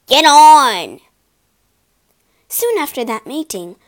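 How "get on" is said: much louder, barked command; loud harsh order; driver's shout. A narrator's reading. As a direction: yell at the horse to move.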